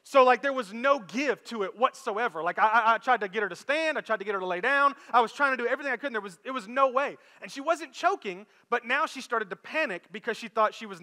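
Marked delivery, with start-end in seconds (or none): none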